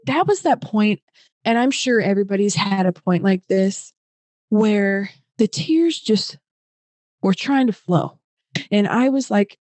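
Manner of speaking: ordinary talk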